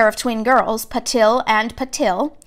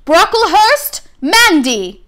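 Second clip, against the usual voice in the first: calling out a name; 8 semitones higher, 4 dB louder, much slower than usual. A narrator's reading. Name called out at sorting.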